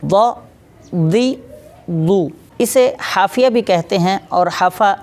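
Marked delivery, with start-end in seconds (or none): 0.0-2.3 व दी दु said heavy, one at a time; example syllables. Shown as a demonstration. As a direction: show व दी दु with full heavy sound. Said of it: "मिसाल के तौर पर देखिए".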